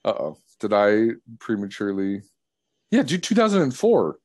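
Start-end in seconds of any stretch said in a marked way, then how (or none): none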